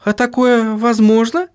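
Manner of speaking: high-pitched, surprised woman's voice. A narrator's reading. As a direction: ask in high-voiced astonishment. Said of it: "удивилась Сахариса".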